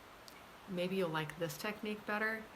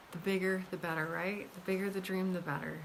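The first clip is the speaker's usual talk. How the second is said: usual speaking voice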